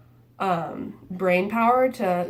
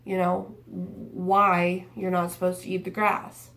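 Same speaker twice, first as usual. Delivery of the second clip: ordinary delivery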